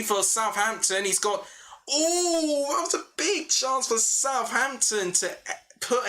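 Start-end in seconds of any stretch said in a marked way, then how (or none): 1.9-6.1 excited gasp. Real, not acted. Commentator reacting to Southampton's big missed chance, excited. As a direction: jump up as the chance flies by.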